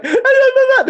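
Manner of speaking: very high mock whimpering babble; hammed-up squeaky stutter; quiver-lip stammer. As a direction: imitate a scared underling about to cry. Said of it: "They start doing that quiver lip".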